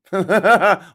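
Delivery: a quick real burst of laughter; short laugh. Real, not acted. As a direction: laugh out loud at your own claim. Laughs at own Scouser claim.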